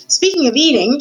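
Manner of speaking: high, loud rat heckle; shouted. A narrator's reading. Hungry rat interrupting the princess, shouting high. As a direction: butt in at a shout. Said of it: "shouted one of the rats".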